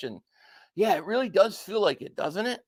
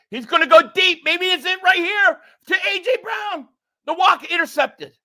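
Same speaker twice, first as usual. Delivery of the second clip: excited play-by-play; 7 semitones higher, 4 dB louder than usual. Real, not acted. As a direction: call the throw as it happens, building hope. Fan calling the Eagles deep pass.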